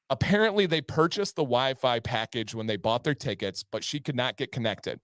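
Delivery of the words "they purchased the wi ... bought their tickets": usual speaking voice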